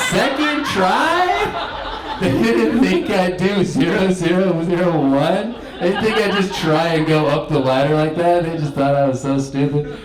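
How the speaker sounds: high giggly laughing talk; laugh-filled retelling; laughing while talking. Real, not acted. Laughing through the tale.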